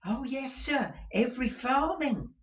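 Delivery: meek woman hurrying to reassure; anxious client assuring the lawyer; timid client. Acted. Humble client answering.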